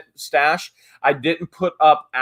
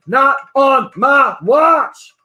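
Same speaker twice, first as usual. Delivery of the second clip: defiant quote; 10 semitones higher, 8 dB louder, much slower than usual. Retold or imitated. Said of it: "Ashley said".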